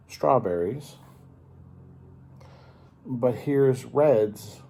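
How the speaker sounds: ordinary delivery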